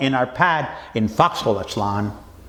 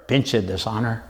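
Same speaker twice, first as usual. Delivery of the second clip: hushed, low and much softer; low whisper; 8 semitones lower, 6 dB quieter than usual. Acted. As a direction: mutter it low under your breath at the judge. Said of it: "and I low whisper".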